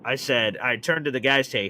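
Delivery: ordinary talk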